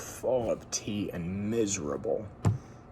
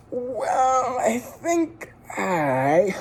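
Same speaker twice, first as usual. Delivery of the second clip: high, slow, strained stammer; sudden nervous Jones; 12 semitones higher, 5 dB louder, much slower than usual. A narrator's reading. Curate trying to leave the tea party, high and halting. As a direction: blurt it out then stall. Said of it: "and said suddenly".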